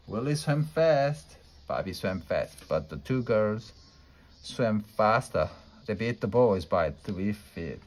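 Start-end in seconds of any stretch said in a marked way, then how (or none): none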